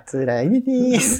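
high, slow laughing mock of the name; laughing as she echoes the fake name. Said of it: "あ、いやいやだ". (笑いながら高くゆっくり)

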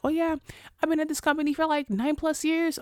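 high, mocking voice of a proud long-timer; mocking the loyal worker, pitched high; mock loyal employee. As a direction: send up the company lifer, pitched high. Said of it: "people who are like".

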